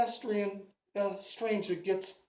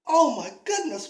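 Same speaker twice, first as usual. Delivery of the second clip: higher, louder Oh my goodness, falling; [name] shocked at his own stalker thought, higher and louder; alarmed at himself; 5 semitones higher, 6 dB louder than usual. Acted. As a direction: jolt back from your own thought. [name] catches himself thinking stalking.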